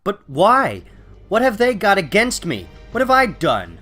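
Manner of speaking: high, loud bewildered pleading; distressed protest. Acted. Hero learning the town has turned against him, distressed. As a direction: demand to know why, hurt and loud.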